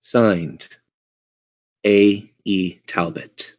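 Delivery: ordinary delivery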